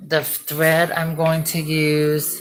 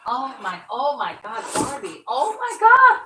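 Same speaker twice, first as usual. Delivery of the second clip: delighted surprise; 8 semitones higher, 4 dB louder than usual. Real, not acted. Finds old Barbies in box.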